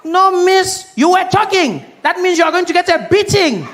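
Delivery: high, loud stern teacher scolding a pupil; angry teacher. Acted. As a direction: bark at the girl in the back row like a strict teacher. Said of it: "teacher walk into the classroom with a cane".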